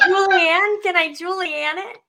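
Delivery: high, loud playful tease. Real, not acted. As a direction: toss it out playfully. Playful wordplay.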